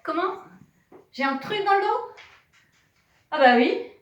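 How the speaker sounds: loud, high, fast playful indignation; hammed-up shock at a fish on her back; mock outrage. Teacher pretending outrage at the class prank.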